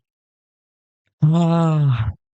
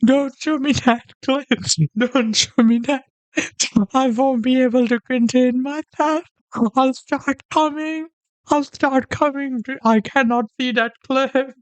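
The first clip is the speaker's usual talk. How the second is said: much higher, whiny fake pleading; mock panic; 7 semitones higher than usual. Streamer begging the video to stop, high hammed-up whine. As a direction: beg them to stop like a scared kid.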